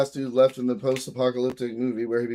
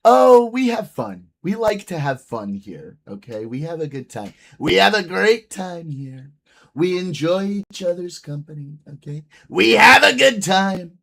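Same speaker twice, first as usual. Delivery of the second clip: hyped host; 5 semitones higher, 9 dB louder than usual. Pretend. Hyped mock host bit.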